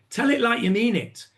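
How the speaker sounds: ordinary talk, nothing marked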